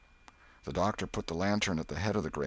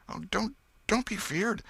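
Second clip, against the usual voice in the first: frightened. Acted. Tom soothes frightened Huck.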